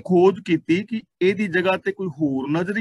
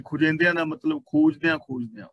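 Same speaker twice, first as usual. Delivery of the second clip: usual speaking voice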